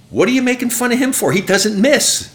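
high, incredulous imitated spectator; heckler's retort. Spectator scolding the heckler next to him, indignant retort. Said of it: "And the guy next to him".

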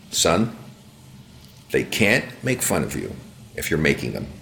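low, quiet fatherly advice; dad's reassurance. Retold or imitated. Father answering his worried son, quietly reassuring. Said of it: "I said, "Son".

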